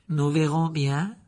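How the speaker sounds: slow, smug and sly; nasty smile. A narrator's reading. Malfoy answers Fudge with a nasty smile. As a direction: let a wicked smile into it. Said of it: "avec un sourire mauvais".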